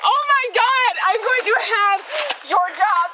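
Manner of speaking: high, loud distressed protests; upset shouting. Real, not acted. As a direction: plead and accuse at once. Distressed during arrest.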